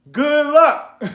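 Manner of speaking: loud, high, laughing jeer; mocking send-off. Real, not acted. To do-it-yourself tax sale buyers, loud mocking send-off. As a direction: holler it mockingly through a laugh.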